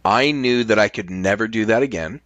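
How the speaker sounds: ordinary talk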